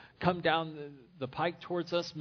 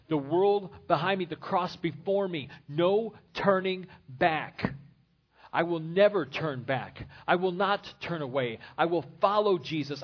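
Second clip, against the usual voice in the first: loud, rising preacher's vow; full-force preaching; 4 dB louder than usual. Acted. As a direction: thunder it out to the congregation.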